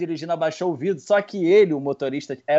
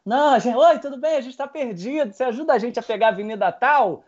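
voz mais aguda e simpática; namorada educada; 6 semitones higher than usual. Retold or imitated. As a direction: imita a namorada pedindo ajuda gentil. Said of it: "assumiu o posto, falou". (namorada pedindo ajuda ao policial, voz aguda e simpática)

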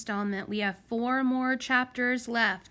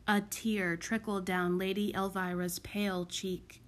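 ordinary delivery

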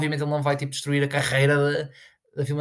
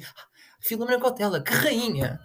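mocking fan voice; 7 semitones higher than usual. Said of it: "é que eles dizem".

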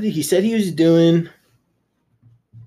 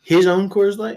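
surprised; 7 semitones higher, 3 dB louder than usual. Real, not acted. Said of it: "disbelieving repeat question".

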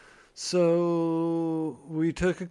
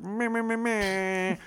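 mock buzzer noise; 6 semitones higher, slower than usual.

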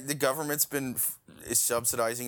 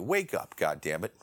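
ordinary talk, nothing marked

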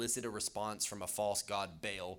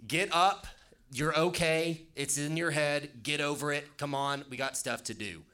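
loud, raised, no-nonsense pep talk; imagined impatient angel; 5 semitones higher, 6 dB louder than usual. Acted. Imagined angel to depressed Elijah, mock brusque scolding. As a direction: rouse someone with brusque, unsympathetic orders. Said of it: "God didn't send an angel down to say".

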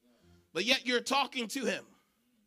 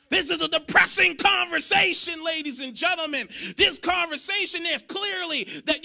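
preaching shout; 5 semitones higher, 6 dB louder than usual. Shown as a demonstration. Preacher booms the line to the church. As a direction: repeat it loud and high, hammering conversation.

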